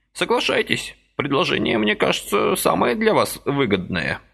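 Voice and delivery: put-on high, chirpy old man's voice; lively old man pressing a deal; brisk old man. A narrator's reading. Lively old man persuading Vasily, high chirpy voice.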